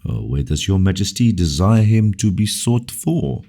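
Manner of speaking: usual speaking voice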